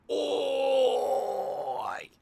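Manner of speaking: loud high Australian oi; shouted Australian oi. Shown as a demonstration. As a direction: bark a mock Australian oi. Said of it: "Why do I keep saying oi?".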